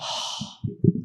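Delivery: startled unvoiced gasp; sharp gasp. Real, not acted. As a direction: gasp in mock horror. Reacting to scary creature.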